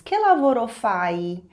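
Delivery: slow, careful Italian demo; slow teacher demo; slow model phrase. Shown as a demonstration. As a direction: model it slowly for learners. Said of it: "Em italiano, você diz".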